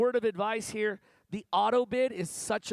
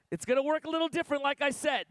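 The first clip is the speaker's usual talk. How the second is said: usual speaking voice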